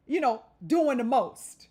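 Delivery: usual speaking voice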